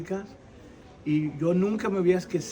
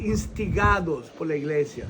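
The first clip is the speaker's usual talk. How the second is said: ordinary talk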